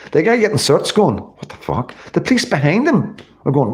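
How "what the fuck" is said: much softer, lower mock reaction; hushed disbelief. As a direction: drop it to a low, hushed aside. Imitating the searched man's reaction.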